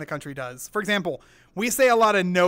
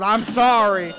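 put-on thick Southern accent; 2 semitones higher, 7 dB louder, slower than usual. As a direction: imitate a Southern guy hollering an apology. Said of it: "his southern accent had a southern accent".

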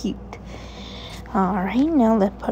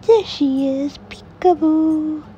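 high, loud, slowed peekaboo; spring the surprise on the baby; delighted peekaboo; peekaboo reveal; 7 semitones higher, 5 dB louder, slower than usual. Acted.

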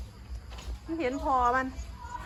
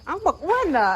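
excited; 7 semitones higher than usual. Real, not acted. Calling out to barking dog.

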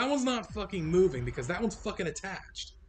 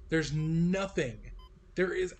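ordinary talk

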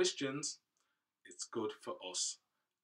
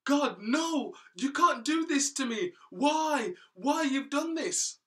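much higher, much louder wailing plea; crying out; 13 dB louder, slower than usual. Acted. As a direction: wail it like a man weeping in despair. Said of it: "Cain was crying and weeping".

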